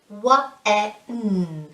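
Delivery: "Wa-e-n" as separate sounds w, e, n; sounded out. Shown as a demonstration. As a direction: sound out "Wa-e-n" one sound at a time. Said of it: "we don't pronounce the D".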